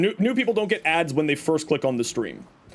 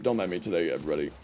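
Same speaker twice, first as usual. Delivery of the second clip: nodding off; 13 semitones lower, 6 dB quieter, much faster than usual. Real, not acted. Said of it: "Sorry, I'm half asleep".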